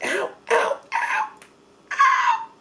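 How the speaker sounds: very high, squeaky ows; acted yelps of pain. Sleeper pulling tape off his back, squeaky yelps.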